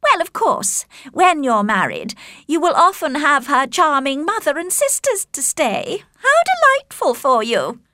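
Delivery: high, sugary sarcasm; mocking Caroline. A narrator's reading. Caroline teasing Darcy about the Bennets, mockingly. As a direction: feign delight at his future in-laws. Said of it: "continued to mock the Bennet family".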